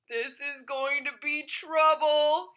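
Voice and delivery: slow, worried mutter to himself; a touch of dread, said slowly; nervous dog voice. Acted. Dog fearing his gas will explode, slow nervous voice. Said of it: "he said to himself nervously".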